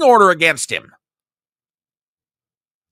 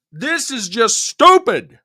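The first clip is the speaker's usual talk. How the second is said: ordinary talk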